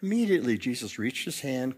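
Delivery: ordinary talk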